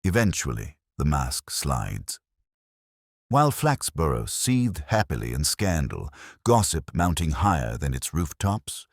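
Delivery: ordinary delivery, nothing marked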